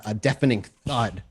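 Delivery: ordinary talk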